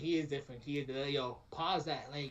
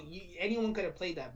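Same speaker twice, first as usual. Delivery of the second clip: usual speaking voice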